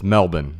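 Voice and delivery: Melbourne as MEL-bin, no r; Australian pronunciation. Shown as a demonstration. Host corrects himself for Australia, local pronunciation. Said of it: "But I know in Australia".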